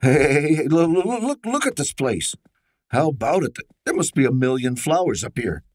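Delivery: loud, stammering amazement; amazed exclamation. Acted. Visitor seeing a roomful of orchids, amazed. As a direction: burst out, tripping over the first words.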